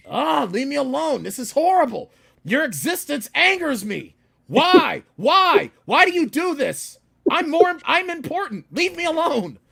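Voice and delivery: loud, high, exasperated ranting; yelling at a driver. Retold or imitated. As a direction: rant at the lady who nearly hit you. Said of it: "and I yelled at her".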